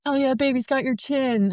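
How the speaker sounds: lightly excited new-dad quote; retold excitement. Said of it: "he was so excited".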